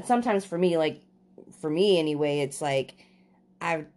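ordinary talk, nothing marked

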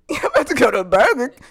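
acted indignant disbelief. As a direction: confront a cheating boyfriend, hammed-up. Girlfriend confronts boyfriend over date.

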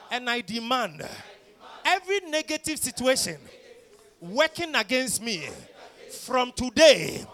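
high, shouted, building preacher fervor; righteous paladin declaration. Acted.

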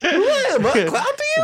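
squeaky, loud and laughing; like he just sucked helium. Retold or imitated. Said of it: "he just rips some helium".

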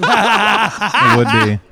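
words squeezed out through laughter; high slow giggling delivery; laughing. Real, not acted. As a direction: laugh while drawling it out slowly. Laughing while pitching musical.

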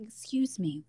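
hushed, whispered aside; soft, embarrassed approach; timid asking. Retold or imitated. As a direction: whisper it as a discreet query. Acts quietly asking someone for help.

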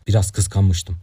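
usual speaking voice

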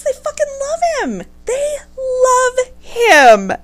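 high, slow wailing complaint; whiny exasperation. Real, not acted. Complaining to listeners that the parents love him, high whiny wail. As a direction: wail it in despair.

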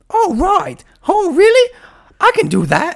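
high, loud hammed-up British accent; squeaky overdone British boy voice; mock British kid. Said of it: "such a good British".

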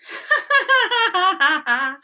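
high giggle at her brother; cheeky little sister's giggle; mocking laugh. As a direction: laugh at his bad counting. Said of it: "laughs Arya".

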